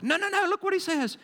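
high-pitched no no no, then look what he says; emphatic correction. Real, not acted. Pastor corrects the church's wrong idea, high emphatic no. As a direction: jump up high to correct them. Preacher corrects congregation's misreading.

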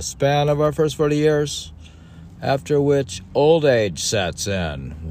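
put-on high, loud, solemn recitation of old text; declaiming recital. Reading an ancient text aloud to a co-host, solemnly declaimed. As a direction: read it like scripture from a lectern.

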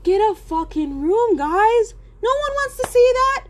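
disgusted inner rant. Retold or imitated. Inner reaction to couple.

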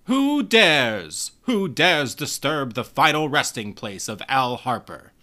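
huge, slow, commanding boom. A narrator's reading. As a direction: thunder it out like a cosmic guardian. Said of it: "another booming voice enters".

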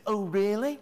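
high mock-impressed sarcasm; sarcastic. Shown as a demonstration. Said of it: "That's sarcasm".

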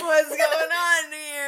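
put-on slow, laughing high kid voice. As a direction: ham up the kid's question while laughing.

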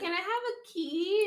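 small voice asking her new boss for a key; mock timid asking. Maid asking her boss for a key, high meek voice.

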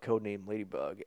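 normal talking voice

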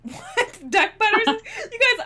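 high squeal of laughter; laughing disbelief. Real, not acted. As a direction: shriek it through laughter. Shocked discovery, laughing.

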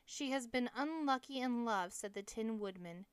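plain reading voice

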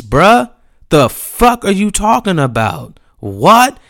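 loud, high outraged protest; pissed-off disbelief. Retold or imitated. Skater refusing blame from the driver, loudly outraged. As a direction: hammer each point with incredulous outrage. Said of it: "this man pissed me off".

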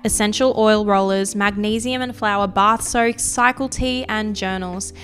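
loud, upbeat sponsor read over music. Acted. Host reads the sponsor ad to listeners, bright ad voice. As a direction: sell the products like a radio host.

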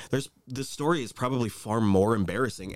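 normal talking voice

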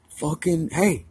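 high, sharp call across the street; a retold call to get his attention. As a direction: hail him from a distance. Calling out to old friend.

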